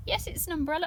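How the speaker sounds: usual speaking voice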